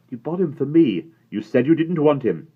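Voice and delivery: high, loud protest; angry retort. A narrator's reading. Omri arguing back at Patrick over the cowboy. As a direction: throw it back at him. Boys quarrelling.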